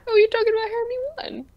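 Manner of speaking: high fake-crying wail of realization; mock wail. Pretending to sob at a friend's joke, high fake wail.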